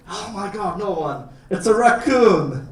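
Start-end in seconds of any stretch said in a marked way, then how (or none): 1.5-2.7 excited. Real, not acted. Showing animals to kids.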